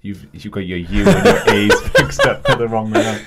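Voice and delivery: loud laugh breaking through the words; laughing correction. Real, not acted. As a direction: crack up while correcting a friend's botched name. Laughing at co-host's botched name.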